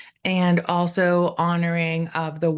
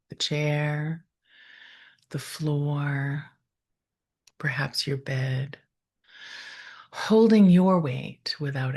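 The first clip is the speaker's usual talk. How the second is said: guided meditation. Real, not acted. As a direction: ease them into stillness, leaving space after each phrase.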